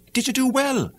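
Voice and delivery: a hint of the old mother greeting her son.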